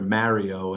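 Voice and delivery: Mario with first vowel as in "marry"; New York pronunciation. Shown as a demonstration. Chair admitting his habit, New York Mario with A as in "marry". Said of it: "That's only New Yorkers call Mario".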